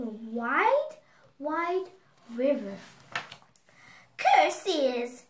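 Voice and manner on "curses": loud, punchy storybook curse; witch's cry. A narrator's reading. Baba Yaga blocked by the wide river, loud cry. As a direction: cry it out loudly as the thwarted witch. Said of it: "cried Baba Yaga".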